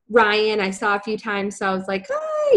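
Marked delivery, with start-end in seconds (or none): 2.1-2.6 very high, squeaky little hello; awkward greeting. Retold or imitated. Newcomer greeting near-strangers at a Christmas party, awkward. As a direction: squeak out a tiny mock greeting.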